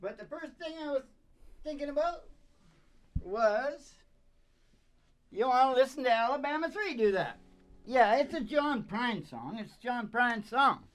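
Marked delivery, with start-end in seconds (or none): 0.0-7.4 a hammy medicine-show huckster; high put-on voice. Dad rambling about music in a high hammy voice.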